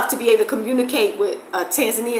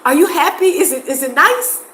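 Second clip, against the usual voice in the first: eager asking; 7 semitones higher, 3 dB louder than usual. Retold or imitated. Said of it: "you have to ask them".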